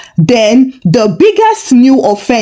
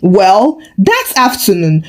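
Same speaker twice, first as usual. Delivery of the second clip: ordinary delivery, nothing marked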